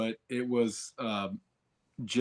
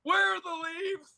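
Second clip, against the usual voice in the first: much higher, squeaky and whiny; squeaky high mock voice. Podcaster repeating Kaylee's forest line, squeaky high mock voice. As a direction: squeak it out like a lost girl.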